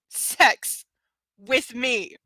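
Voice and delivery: "Sex", set apart from "with me" by a pause; dramatic pause. A narrator's reading. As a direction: hang a beat after "Sex" before "with me". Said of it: "Why is there a pause there?".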